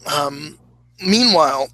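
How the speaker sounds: ordinary talk, nothing marked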